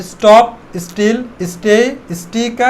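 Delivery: normal talking voice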